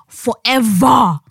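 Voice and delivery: "forever" slow and loud, falling; stress on forever. Real, not acted. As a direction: stretch out "forever". Dramatic emphasis.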